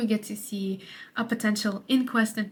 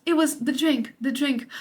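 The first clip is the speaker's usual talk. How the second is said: shouting; 4 semitones higher, 3 dB louder than usual. Retold or imitated. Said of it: "The queen shouts".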